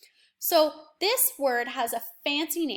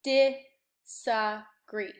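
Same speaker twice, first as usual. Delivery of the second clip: disagree stretched very slowly, each syllable held apart; 2 semitones lower, much slower than usual. Shown as a demonstration. Teacher sounding out disagree for the class, very slow. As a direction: drag disagree out into three long beats. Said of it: "The I is in the di".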